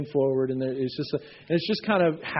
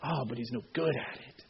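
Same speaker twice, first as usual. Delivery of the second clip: softer, sneering accuser; a hint of a sneering accuser; 4 semitones lower, 10 dB quieter than usual. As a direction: undermine him quietly. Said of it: "the accuser is telling you".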